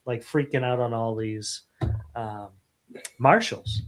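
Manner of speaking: ordinary talk, nothing marked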